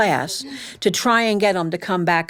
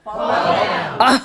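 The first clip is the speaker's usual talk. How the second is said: crowd shout. Real, not acted. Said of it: "That feels so good".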